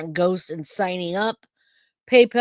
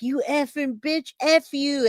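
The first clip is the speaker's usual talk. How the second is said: mild mock yell, pitch raised; retold angry yelling; 6 semitones higher than usual. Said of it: "yelling at me".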